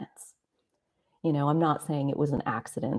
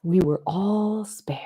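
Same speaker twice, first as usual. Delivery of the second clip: whispered; 4 semitones higher, slower than usual. Real, not acted. Quiet awed realization, whispered.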